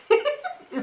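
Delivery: squealing laugh; high burst of laughter. Real, not acted. Laughing at scene.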